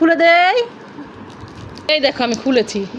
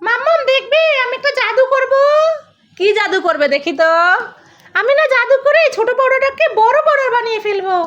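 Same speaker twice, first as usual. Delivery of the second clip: put-on higher, eager little child; childlike character voice; 8 semitones higher than usual. Little character tells mummy about her magic trick, eagerly.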